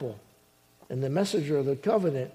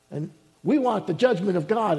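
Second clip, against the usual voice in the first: normal talking voice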